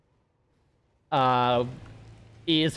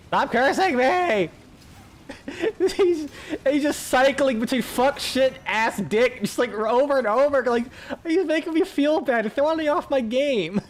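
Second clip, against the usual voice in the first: mock protest; 10 semitones higher than usual. Real, not acted. Gamer protesting to chat about being cursed and thrown off, high mock complaint. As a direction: protest in a laughing, raised voice well above your usual.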